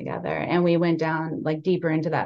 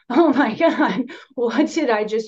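shocked realization; 6 semitones higher, 6 dB louder than usual. Retold or imitated. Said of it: "I just was like".

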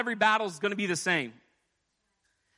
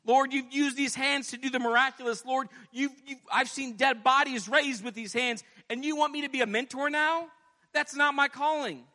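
higher indignant protest to God; protesting Elijah; 7 semitones higher than usual. Retold or imitated. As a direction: object as if the new role is beneath you. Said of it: "Elijah could have said".

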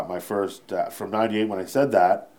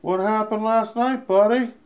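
Harlan impression; 12 semitones higher than usual. Harlan on the airplane, pointed high Harlan voice. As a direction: needle him the next morning, pitched high. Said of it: "brought it up how?".

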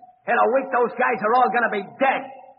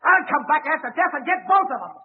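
ordinary talk

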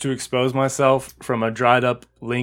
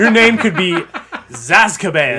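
loud, high, excited riff; 6 semitones higher, 7 dB louder than usual. Real, not acted. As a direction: hype it up loud.

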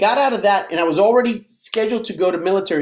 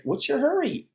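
higher, softer questioning voice; mock puzzled doctor; 10 semitones higher, 5 dB quieter than usual. Doctor asking an impatient patient, mock puzzled. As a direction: lightly imitate a puzzled doctor, pitched up.

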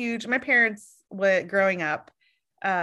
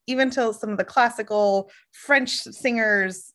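ordinary talk, nothing marked

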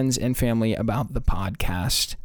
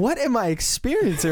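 higher, incredulous retold reaction; bewildered; 8 semitones higher than usual. Driver staring at the rabbit man, bewildered. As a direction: act out total disbelief, pitched up.

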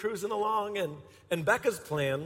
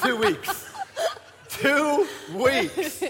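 laughing; 9 semitones higher, much slower than usual. Real, not acted. Admitting he'd known her two weeks.

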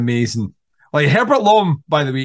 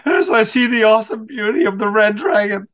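a light imitation of a movie killer, pitched higher; mock eerie serial killer, higher; creepy killer voice; 6 semitones higher than usual. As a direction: intone it like a lightly done movie psycho in a trance. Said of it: "I may watch Manhunter tonight".

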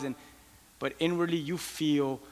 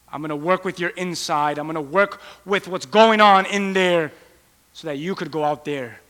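louder, rising preacher's cry; preaching at full force; 3 semitones higher, 10 dB louder than usual. Acted. Preacher promising God's inner work to the congregation, full force. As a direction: drive it home from the pulpit.

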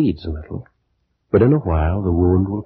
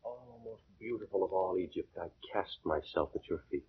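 hushed devotion; 17 dB quieter than usual. Acted. Suitor kneels before beloved.

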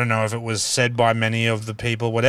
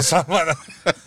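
normal talking voice

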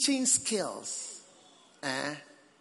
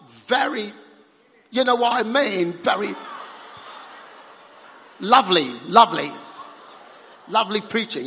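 loud, higher praise on lovely; excited praise; 5 semitones higher, 7 dB louder than usual. Real, not acted. Preacher gushing to British-accented listeners about their lovely preaching. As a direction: gush over their accents.

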